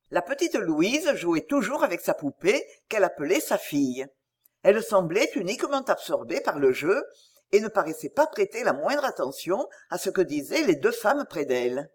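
ordinary delivery

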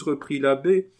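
ordinary delivery, nothing marked

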